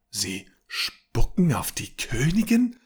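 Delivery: can't believe what she's hearing; incredulous. Acted. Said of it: "unterbrach Lisha ungläubig". (ungläubig, höher, mit Betonung auf „Königin“)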